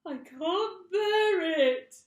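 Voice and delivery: very high, loud, slow wail; anguished cry. A narrator's reading. Mrs Twit wailing in despair to her husband. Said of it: "cried Missus Twit".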